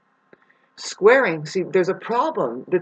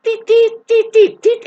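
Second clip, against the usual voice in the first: very high, loud piping "deet, deet" like a digital watch; watch beep imitation; 9 dB louder than usual. Recalling her childhood digital watch, very high loud mock beeps. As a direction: chirp it out like a kid's digital watch beeping. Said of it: "my digital watch that".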